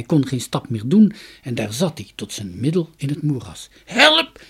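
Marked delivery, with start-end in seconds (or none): 3.8-4.4 very high, loud yell; alarmed high-pitched call; cry for help. A narrator's reading. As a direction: shout for rescue, voice shooting up. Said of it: "riep Jorkos".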